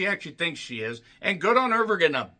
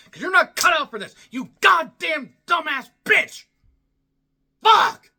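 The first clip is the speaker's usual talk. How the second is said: real furious high-pitched shout; angry shouting; 10 semitones higher, 4 dB louder than usual. Real, not acted. Fan raging at a wrestler not cut out for this, shouting. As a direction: blow up, yelling the insults at full pitch. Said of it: "I'm sorry for shouting".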